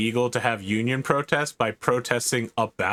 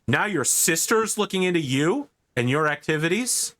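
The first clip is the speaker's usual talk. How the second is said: rising angry father; 6 semitones higher than usual. Acted. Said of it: "he goes".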